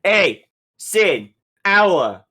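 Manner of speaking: high, loud yell across the room. Acted. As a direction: holler the question to your friends. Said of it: "I'm yelling now".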